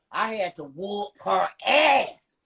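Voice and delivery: normal talking voice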